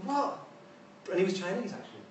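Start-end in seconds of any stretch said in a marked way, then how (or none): 0.1-0.4 incredulous what. Retold or imitated. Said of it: "the clever professor ... was going".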